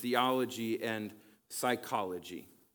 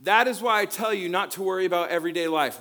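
higher, louder and fast, driving the verse home; emphatic preaching; 7 semitones higher, 9 dB louder, faster than usual. Shown as a demonstration. Pastor restarts the verse for the congregation, loud and emphatic. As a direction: proclaim it to the whole room.

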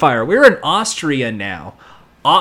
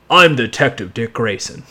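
low-pitched put-on accent; Dick Grayson; 6 semitones lower than usual. Showing an actor's inconsistent accents, low official take. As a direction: drop low into another accent. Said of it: "like, six different accents".

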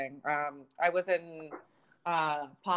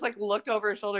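usual speaking voice

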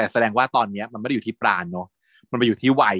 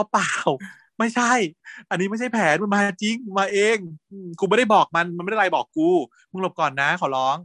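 flustered pleading; 7 semitones higher than usual. Retold or imitated. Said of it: "ปานก็อ้อนให้พัดหลบ".

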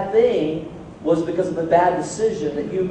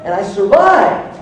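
normal talking voice

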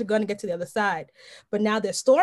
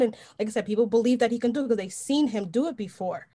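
ordinary delivery

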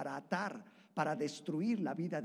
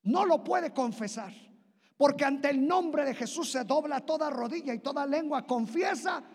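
high, loud, shouting sermon; preaching at full force; 9 semitones higher, 10 dB louder than usual. Acted. Preacher shouting the name of Jesus to the church. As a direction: proclaim the power of the name.

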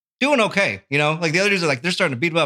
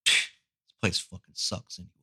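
lower, quieter sulky grumble; low grumbling customer; 9 semitones lower, 8 dB quieter than usual. Retold or imitated. Angry customer grumbling as he's thrown out of the shop. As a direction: grumble it under the breath like a sore loser.

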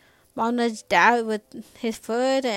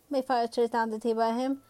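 plain reading voice

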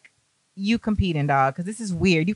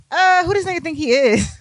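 indignant; 9 semitones higher than usual. Retold or imitated. Annoyed at being shown up.

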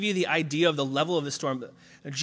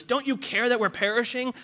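high pleading; 8 semitones higher than usual. Retold or imitated. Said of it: "when they ask Jesus".

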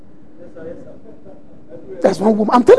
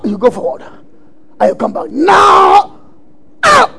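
mock commanding shout; 6 semitones higher than usual. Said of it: "I did it before too".

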